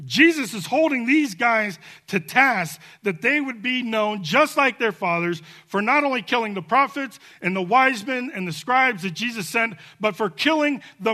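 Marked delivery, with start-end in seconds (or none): none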